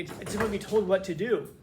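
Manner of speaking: ordinary talk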